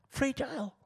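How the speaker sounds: Fragile lifted high and hushed, set apart; a high, breathy echo of Fragile; echoed word. Shown as a demonstration. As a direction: echo Fragile softly up high, like it might break. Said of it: "It's so fragile".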